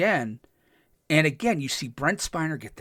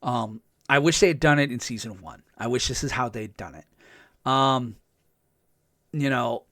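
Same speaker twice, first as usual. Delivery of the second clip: usual speaking voice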